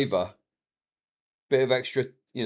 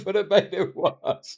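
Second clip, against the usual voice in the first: normal talking voice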